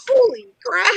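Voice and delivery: high-pitched startled exclamation; high mock shock; surprised. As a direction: react to the sudden wreck ahead. Driver seeing the wreck.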